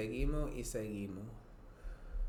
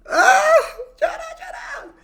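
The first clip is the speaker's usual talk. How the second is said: very high, loud cry breaking into sobs; 17 dB louder than usual. Real, not acted. Vlogger sobbing the song's refrain at full voice. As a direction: cry the words out as you break down. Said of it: "Wow, we cried".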